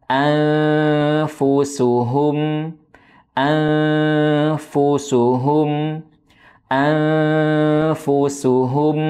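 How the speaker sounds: "Anfusuhum" very slow, each dhammah u held with rounded lips; over enunciating. Shown as a demonstration. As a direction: drag out "anfusuhum" with full rounded u. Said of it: "Yang lebih tepat adalah di antara keduanya".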